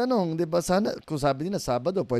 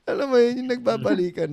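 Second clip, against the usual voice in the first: laughing; 9 semitones higher, 4 dB louder than usual. Real, not acted. Laughs after retelling wife.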